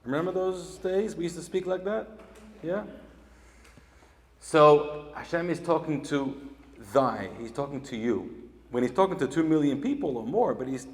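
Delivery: normal talking voice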